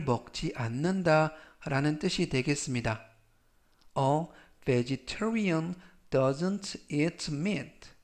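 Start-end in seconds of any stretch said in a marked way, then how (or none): 4.0-7.9 very slow, clear English sentence; slow reading. Shown as a demonstration. Teacher reads for students to repeat, very slow. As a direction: spell it out slowly for learners to repeat. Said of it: "제가 역시 천천히 세 번씩 읽어드리고요".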